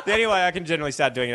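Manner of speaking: usual speaking voice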